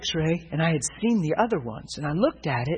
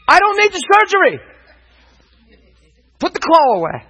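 high, loud mock protest; insistent shout; 12 semitones higher, 8 dB louder than usual. Patient retold refusing surgery to staff, insistent shout. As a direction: refuse it at full voice.

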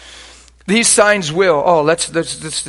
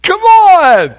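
shout; 14 dB louder than usual. Real, not acted. Preacher rallying the congregation.

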